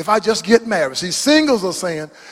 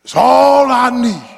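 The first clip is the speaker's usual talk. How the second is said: high, shouted preacher's cry; preaching at full force; 5 semitones higher, 4 dB louder, much slower than usual. Acted. Preacher proclaiming the psalm to the church. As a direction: ring out Jesus then slow down.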